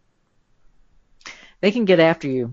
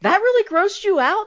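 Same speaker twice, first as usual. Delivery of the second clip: mock surprise. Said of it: "I'm like".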